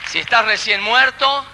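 ordinary talk

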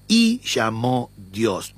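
"Y" stressed and slowed. Shown as a demonstration. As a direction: lean on the "Y", read slowly. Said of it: "Yo voy a darle énfasis en la "y". (la "Y" enfatizada y lenta)

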